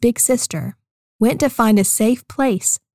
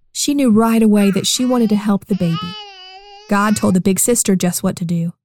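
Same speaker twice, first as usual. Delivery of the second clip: ordinary talk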